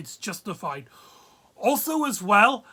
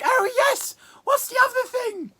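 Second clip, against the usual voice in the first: high squeaky Velma. Retold or imitated. Reviewer mimicking Velma accepting the proposal, high and eager. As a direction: squeal the yes like an overeager fiancée.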